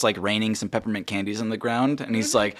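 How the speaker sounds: ordinary talk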